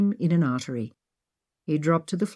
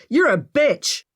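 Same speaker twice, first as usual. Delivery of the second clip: angry; 8 semitones higher, 4 dB louder, slower than usual. Acted. Angry insult in quarrel.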